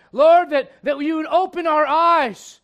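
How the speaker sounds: very loud, high, stretched plea; pleading cry. Acted. Blind Bartimaeus begging the Lord for sight, crying out. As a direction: cry out to be heard, drawing out the end.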